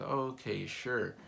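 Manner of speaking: high, bright-voiced lady agreeing; cheerful store lady. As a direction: chirp it like the friendly cashier.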